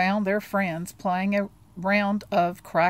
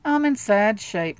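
sighing; 3 semitones higher, 5 dB louder than usual. Acted. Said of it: "with a sigh".

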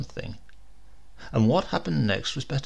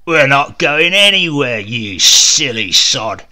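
roaring; 11 dB louder than usual. Acted. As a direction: bellow it down at him. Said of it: "Now he roared louder still".

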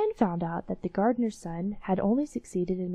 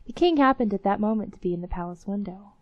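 plain reading voice

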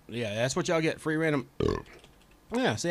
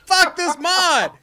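loud, high, drawn-out curse; real frustrated yell; 12 semitones higher, 12 dB louder, much slower than usual. Real, not acted. Streamer cursing the game mod, frustrated shout. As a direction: yell it slowly in raised pitch.

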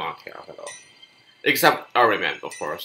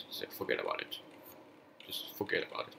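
softer, whispered resignation; whispered giving up; 11 dB quieter, faster than usual. Real, not acted. Gamer giving up the fight, whispered resignation. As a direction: let it go under your breath.

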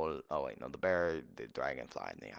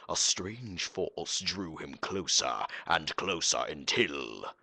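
low, dramatic storyteller narration; narrator voice; 4 semitones lower than usual. Acted. Gamer narrating the fight as an epic story, low narrator voice. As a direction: narrate the battle like an epic tale. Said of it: "Narrator voice".

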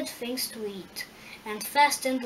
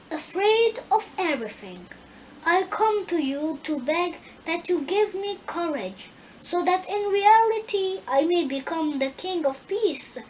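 higher, louder, earnest pleading voice; cowardly Lion; 5 semitones higher, 5 dB louder than usual. A narrator's reading. Cowardly lion begging the Wizard for courage, pleading. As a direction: plead as the timid lion, pitched up.

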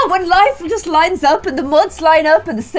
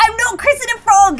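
higher, put-on voice of a grossed-out princess; disgusted refusal; 8 semitones higher, faster than usual. Tiana refusing the frog's kiss, a disgusted put-on voice. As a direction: recoil from the frog in a voiced refusal. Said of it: "She's like".

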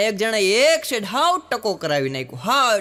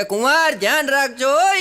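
stern father-in-law; 6 semitones higher, 5 dB louder than usual. Acted. Father-in-law warning son-in-law.

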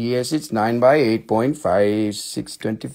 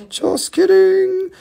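squeaky mock; slower than usual. Second take, high and slow.

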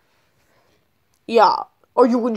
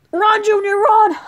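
high, louder call to flee; urgent shout; 10 semitones higher, 5 dB louder than usual. Acted. Dad urging his son to flee the store, urgent high shout. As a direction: yell it urgently to get him moving.

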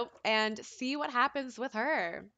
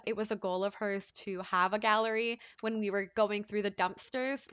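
ordinary talk

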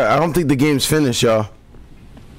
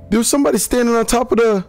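cracking, pitched-up voice he can barely hold; strained lost voice; 9 semitones higher, much faster than usual. Real, not acted. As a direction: push through a voice that's giving out, much higher. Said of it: "I really lost my voice".

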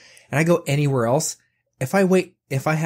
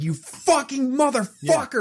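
high, drawn-out furious cursing; mock top-of-the-lungs rage, pitch way up; retold yelling; 13 semitones higher, slower than usual. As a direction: re-enact screaming at a phone robot. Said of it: "just yelling at the top of my lungs".

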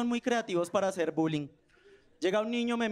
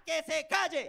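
put-on child's yell; 7 semitones higher, slower than usual. Niño que le pega al otro.

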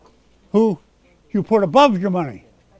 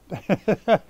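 short chuckle to himself; amused little chuckle. Real, not acted. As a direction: chuckle at your own joke. Amused by his lawn-mowing aside.

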